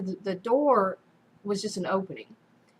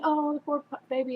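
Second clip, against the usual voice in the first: higher-pitched pitying coo, lightly imitated; mock sympathy; 7 semitones higher than usual. Older women pitying a baby in retelling, mock sympathetic.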